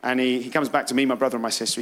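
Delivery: ordinary talk, nothing marked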